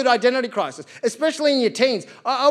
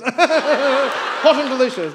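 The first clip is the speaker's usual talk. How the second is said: laughing, slow and louder; 4 semitones higher, 5 dB louder, much slower than usual. Acted. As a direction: savour it through a laugh. Savouring the punchline.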